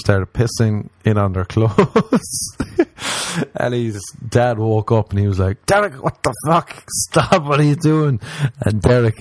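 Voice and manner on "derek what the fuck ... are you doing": high, startled fatherly outburst; a lightly voiced shocked father yelling at his son; mock angry dad. Dad catches drunk son pissing.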